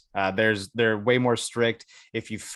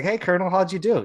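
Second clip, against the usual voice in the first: eager question to colonel; 9 semitones higher, 4 dB louder than usual. Retold or imitated. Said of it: "It's like".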